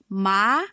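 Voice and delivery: "Ma" gliding upward; second tone. Shown as a demonstration. Teacher showing the second tone, "Ma" going up. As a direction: let "Ma" climb like a question. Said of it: "Second tone is going up".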